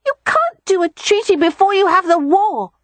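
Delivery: put-on a boy objecting to his aunt; protesting little boy. A narrator's reading. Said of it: "Rye protested".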